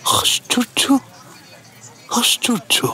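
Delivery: high astonished murmur under the breath; muttering to himself. Retold or imitated. Narrator muttering to himself over the double, high astonished murmur. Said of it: "বললাম".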